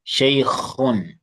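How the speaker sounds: Shaykhun with a throaty kh from the throat; model pronunciation. Shown as a demonstration. Teacher models kha for a struggling student, throaty kh. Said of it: "work on the way you pronounce kha".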